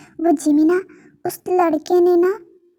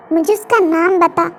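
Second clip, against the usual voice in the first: plain reading voice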